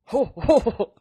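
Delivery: loud, high punching ho ho ho; Santa ho ho ho. Pretend. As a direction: belt each ho like a punch. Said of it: "So I'm gonna be like".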